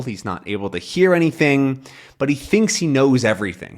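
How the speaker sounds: ordinary delivery, nothing marked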